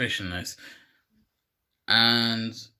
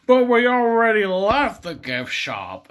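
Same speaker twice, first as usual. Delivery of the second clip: loud, raised cartoon dad voice; Homer Simpson; 7 semitones higher, 7 dB louder than usual. Homer answers Bart's request, loud put-on dad voice. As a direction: bellow it as a protesting cartoon father. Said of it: "Homer says".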